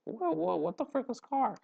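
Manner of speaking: high, baffled cry of confusion, hammed up; confused mock exclamation. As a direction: play the program as lost and bewildered. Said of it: "And we're like".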